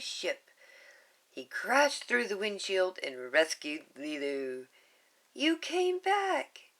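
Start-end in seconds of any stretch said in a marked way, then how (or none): 5.3-6.7 much higher, slow, wondering; girl amazed her friend returned; amazed little girl. Acted. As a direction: let relief break through. Lilu rescued by Stitch.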